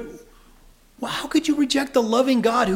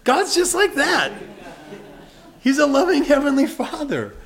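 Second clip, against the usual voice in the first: higher and louder, laughing; joyful proclamation; 7 semitones higher, 4 dB louder than usual. Real, not acted. Preacher telling parents God is like them, joyfully. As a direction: beam it out to the room.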